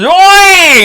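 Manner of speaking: very high loud yell; drunk's shout. Retold or imitated. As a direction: bellow back in through the door. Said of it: "and he goes".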